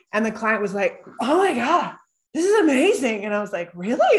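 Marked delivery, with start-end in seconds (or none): 1.2-3.2 higher, louder amazed gush; amazed client. Retold or imitated. 3.7-4.2 high, loud disbelieving really; surprised. Retold or imitated. Her own surprised reply.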